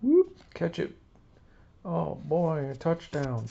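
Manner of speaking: high, excited goofy voice, yelping on the catch; Gerald Ford puppet voice. Acted. Gerald Ford catching the football, high goofy yelp. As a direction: cheer like a kid at a game. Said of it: "There you go, Gerald Ford".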